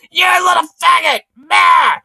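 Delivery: hammed-up, high-pitched shouting; mock the ranting man at full pitch; imitated yelling. Streamer repeats man's insults, high mock shout. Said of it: "he was saying also to that guy's like".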